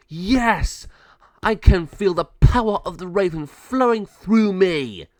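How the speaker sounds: loud, high power-mad proclamation; glory in it at full voice; exultant villain. Acted. Malvado transformed into a raven, triumphant.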